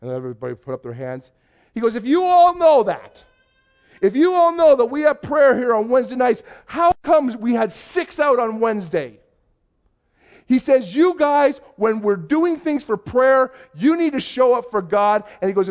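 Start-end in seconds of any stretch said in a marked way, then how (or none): 2.0-9.2 loud, high scolding from the pulpit; imitating a preacher berating the flock; preaching pastor. Pastor confronts congregation over poor prayer turnout, preaching rebuke. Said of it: "he starts preaching". 10.9-15.2 raised, emphatic pulpit scolding; imitating a preacher laying down the law; preaching pastor. Pastor scolds congregation for skipping prayer, preaching rebuke. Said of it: "he starts preaching".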